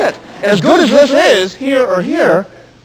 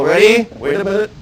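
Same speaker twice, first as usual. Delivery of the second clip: ordinary delivery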